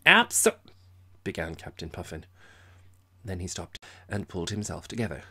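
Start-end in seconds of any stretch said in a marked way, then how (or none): 0.1-0.6 loud high slurred start; drunken blurt. A narrator's reading. Drunk Captain agreeing with Major, loud and high. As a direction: blurt it and stall.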